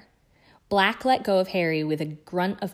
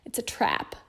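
whispered through gritted teeth in pain; hushed and strained with pain; 5 semitones higher, 4 dB quieter than usual. Acted. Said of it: "His teeth were gritted with pain".

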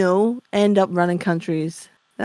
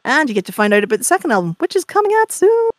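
quick, then jumps much higher like a show host; mock host voice; 6 semitones higher, faster than usual. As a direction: pretend to take over the podcast with a host's pitch. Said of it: "Sorry, I'm just taking over".

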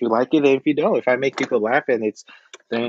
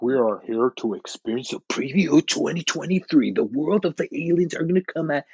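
movie trailer narrator voice; movie preview guy. Acted. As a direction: announce it like a dramatic trailer. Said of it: "I know how to do his voice exactly".

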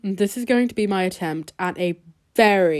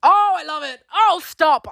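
mock swooning; 9 semitones higher, 4 dB louder than usual. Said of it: "It would be like".

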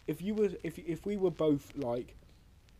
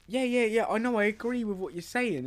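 eager agreement; 8 semitones higher, 5 dB louder than usual. Real, not acted. Eager to agree mid-argument.

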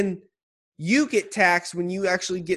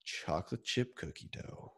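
soft, unvoiced whisper; dreamy whisper about chocolate chip cookie dough; ASMR whisper; 11 semitones lower, 19 dB quieter than usual. Real, not acted. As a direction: whisper the words like a secret indulgence. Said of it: "turning into an A S M R channel".

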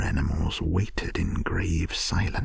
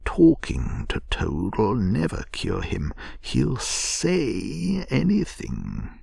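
scornful, rising to a higher finish; contemptuous; 6 semitones higher, slower than usual. A narrator's reading. Rat doubting that Toad can be cured, contemptuous. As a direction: dismiss it with disdain, drawing out the end. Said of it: "said the Rat contemptuously".

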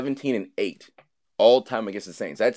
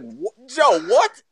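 high, loud incredulous what; mock shock; 13 semitones higher, 5 dB louder, much slower than usual. Host acts out hearing Joe's kiss request, high mock shock. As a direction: blurt it out in acted disbelief. Acted shocked reply to Joe.

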